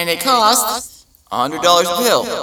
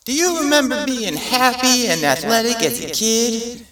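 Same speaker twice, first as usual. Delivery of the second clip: high, loud sales pitch; cheesy ad announcer; 9 semitones higher, 4 dB louder than usual. Retold or imitated. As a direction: hook them like a TV ad. Mock mental health ad.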